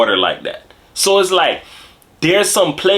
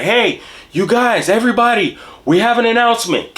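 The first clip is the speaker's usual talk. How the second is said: higher, announcer-style boss voice; mock foreman announcement; 8 semitones higher than usual. Foreman over a bullhorn, higher and proud. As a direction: hype it up like a corporate foreman.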